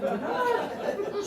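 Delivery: slow, high horrified gasp; retold shock. Stylist seeing the hair break in the sink, slow shocked gasp. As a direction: stretch it out in mock horror.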